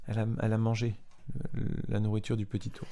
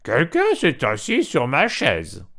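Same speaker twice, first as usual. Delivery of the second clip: louder, a bit higher, slow mama bear cry; mama bear's voice; 5 semitones higher, 7 dB louder, slower than usual. A narrator's reading. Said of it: "s'écria d'une voix moyenne". (voix moyenne de maman ours, un peu plus forte)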